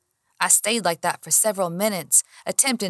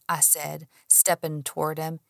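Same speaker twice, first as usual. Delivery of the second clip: usual speaking voice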